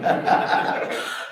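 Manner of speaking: quick breathy chuckle at himself; short laugh. Real, not acted. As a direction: laugh it off, brief and unvoiced. Laughs at own tired joke.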